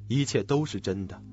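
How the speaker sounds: usual speaking voice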